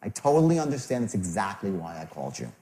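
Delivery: sincere, falling to low and soft; empathetic, as if you care; caring tonality. Shown as a demonstration. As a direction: let it settle into quiet reassurance. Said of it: "How does that sound?".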